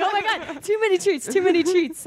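high, laughing retold alarm; mock panic. Retelling being mobbed by sheep, high mock panic. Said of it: "I was like".

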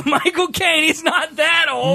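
high, slow laughing protest; laughing shout. Real, not acted.

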